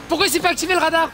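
indigné, beaucoup plus aigu et fort; s'étonne bruyamment que le radar rate; surpris. Real, not acted. Le radar ne flashe pas. (surpris et indigné)

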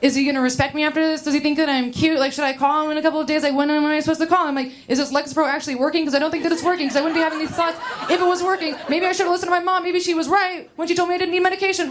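fast, high, spiralling inner panic; anxious inner voice spiralling about a hookup; anxious racing thoughts. Retold or imitated. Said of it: "I get, like, a lot of racing thoughts".

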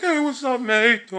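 Hula Hula voice. Retold or imitated. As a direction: greet him breezily, a touch of the cartoon buddy. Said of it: "He's like".

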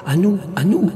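high, swooping cry; chorus cry. A narrator's reading. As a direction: call out as a whole choir of spirits. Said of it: "cria le chœur".

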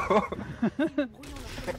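high laugh. Real, not acted. Laughs at useless minutes.

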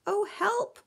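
high, loud cry for help; frightened fish's high wail; moaning plea. A narrator's reading. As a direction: moan it high and loud in alarm. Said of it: "moaned the mackerel".

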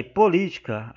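POLÍTICA alto e forte, com acento no LI; tônica no li. Shown as a demonstration. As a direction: marcar bem o LI de POLÍTICA. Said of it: "o acento está no li". (POLÍTICA enfático, tônica em LI)

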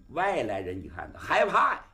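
plain reading voice

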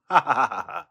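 a sudden high laugh at his own joke; loud burst of laughter. Real, not acted. Laughing at his own doppelganger joke.

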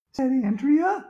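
high schoolgirl voice on "said Andrea"; girl's voice. A narrator's reading. Reader stays in Andrea's voice for the tag, high-pitched.

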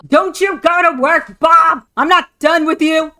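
hammed-up outraged shout; shrill mock yell. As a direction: yell after him as he walks off. Screaming after Bob leaving.

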